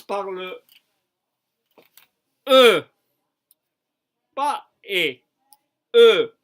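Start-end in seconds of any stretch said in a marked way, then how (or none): 2.5-2.8 "E" said with rounded lips as if for O; French E sound. Shown as a demonstration. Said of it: "La bouche pour dire O, mais vous dites un". 5.9-6.3 "E" said with rounded lips as if for O; French E sound. Shown as a demonstration. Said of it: "La bouche pour dire O, mais vous dites un".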